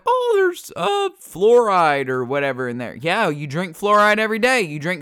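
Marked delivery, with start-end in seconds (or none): 0.1-2.9 a squeaky mock gasp on oh, then loud whining; lightly hammed panicked voice; mocking panicky anti-vaxxer. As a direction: parody the freaked-out crowd. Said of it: "The people who are freaking out like".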